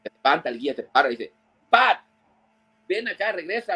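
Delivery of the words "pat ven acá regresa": high, urgent call of a name. Retold or imitated. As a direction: call him back fast before it's too late. Guide calls Pat back. (el guía lo llama con urgencia, agudo)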